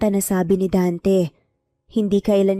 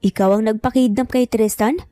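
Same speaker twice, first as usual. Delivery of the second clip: usual speaking voice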